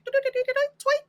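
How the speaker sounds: high chirpy tweet like a little bird. Pretend. Mocking Andre's bird chest with a chirp.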